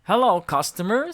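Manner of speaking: bouncy salesman monster; Grover voice. A narrator's reading. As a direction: sell it like a cheery blue monster. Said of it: "said Grover".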